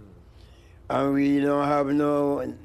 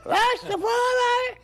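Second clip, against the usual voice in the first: very high, drawn-out falsetto crow; slow piercing cock-a-doodle delivery; rooster crow; 3 dB louder, slower than usual. Retold or imitated. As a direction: crow the word like a cockerel. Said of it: "says my back".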